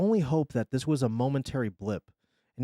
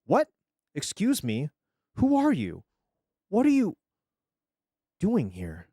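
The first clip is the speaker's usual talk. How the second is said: high-pitched startled demand; startled, assertive; 6 semitones higher, 4 dB louder than usual. A narrator's reading. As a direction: confront an intruder, pitch leaping up. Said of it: "into the same assertive adult".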